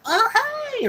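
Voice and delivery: very high-pitched, drawn-out greeting; super excited. Retold or imitated. Kid greets his new guardian, super excited. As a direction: act out a kid thrilled to meet someone. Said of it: "super excited to see her".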